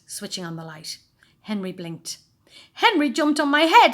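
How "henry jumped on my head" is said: snivelling complaint. A narrator's reading. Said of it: "snivelled a familiar voice".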